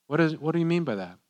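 ordinary talk, nothing marked

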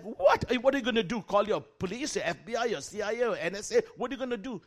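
high, loud rapid questioning; frantic disbelief. Retold or imitated. Teacher urges his student to call the police, high and alarmed. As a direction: demand answers in alarm.

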